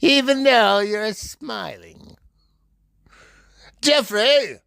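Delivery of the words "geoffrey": calling out. Acted. Calling a friend over.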